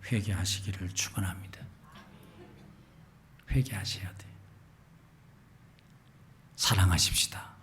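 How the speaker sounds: soft whispered urging; hushed plea. Real, not acted. Preacher whispering to the congregation to repent and love.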